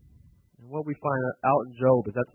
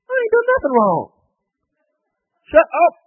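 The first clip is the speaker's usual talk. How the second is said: much higher and louder, a furious outburst; angry Job; 13 semitones higher, 7 dB louder than usual. Acted. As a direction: snap back at the accusing friends. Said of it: "Job's getting angrier and angrier".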